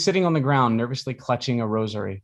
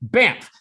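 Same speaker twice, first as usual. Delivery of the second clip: loud bamf sound effect; 11 semitones higher, 6 dB louder than usual. Acted.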